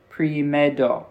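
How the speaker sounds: "primero" with the R said like an English D; R as D sound. Retold or imitated. Learner tries out friend's advice, "primero" with R as a D. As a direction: sound out "primero" slightly carefully, tapping the R as a D. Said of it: "pronouncing one of the R's like it's a D".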